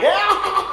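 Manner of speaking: high villain cackle "ah"; mock maniacal laughing yelp; imitated manic laugh. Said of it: "Goblin's just laughing".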